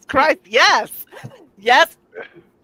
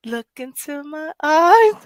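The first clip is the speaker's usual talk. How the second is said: higher, louder, slow mock seductive line; mock vampire lure; 7 semitones higher, 7 dB louder, slower than usual. Host imitating the poet's line, hammed-up and slow. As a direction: ham up her spooky invitation. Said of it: "She goes".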